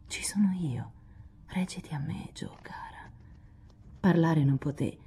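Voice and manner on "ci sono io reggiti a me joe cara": soft whisper fading to nothing; whispered comfort. A narrator's reading. Lori comforting weeping Joe, choked whisper. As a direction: breathe it close to her ear. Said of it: "le bisbigliò sforzandosi di vincere il nodo alla gola".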